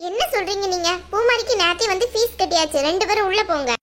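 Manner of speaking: ordinary delivery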